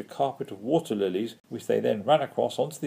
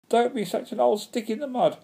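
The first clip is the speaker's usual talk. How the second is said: high-pitched cheeky heckle; cheeky yell; 12 semitones higher, 3 dB louder than usual. A narrator's reading. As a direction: taunt them in a high voice. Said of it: "yelled one particularly cheeky Pook".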